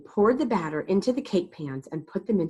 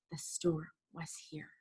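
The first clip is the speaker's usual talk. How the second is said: whisper; 2 semitones lower, 14 dB quieter than usual. A narrator's reading. Hushed narration.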